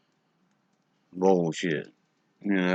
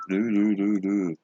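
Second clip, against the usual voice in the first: mock cop voice, higher and louder; 3 semitones higher, 4 dB louder than usual. Shown as a demonstration. Showing off his dumb cop voice to the stream. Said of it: "when I do my cop voice".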